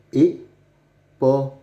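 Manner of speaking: "épaule" drawn out slowly, every syllable clear; sounded out slowly. Shown as a demonstration. Teacher showing young readers the word, slowly sounded out. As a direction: stretch out "épaule" so pupils hear each sound. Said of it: "ce dessin nous montre une".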